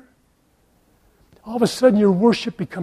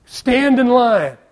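higher, louder, slow firm order; firm command of God; 5 semitones higher, 5 dB louder, much slower than usual. Acted. Preacher voicing God ordering Hill to stay. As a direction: lay down the command slowly and firmly.

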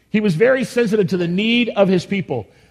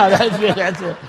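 laughing; 4 dB louder than usual. Real, not acted. Laughing after dab.

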